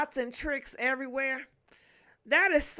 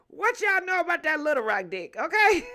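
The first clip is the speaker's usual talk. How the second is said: teasing hype; 4 semitones higher, 5 dB louder than usual. Real, not acted. Teasing viewers about Little Rock.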